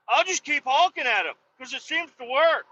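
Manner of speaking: high-pitched mocking neighbor voice; defiant neighbor. Neighbor refusing to stop honking at the dogs, defiant. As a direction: imitate him digging in.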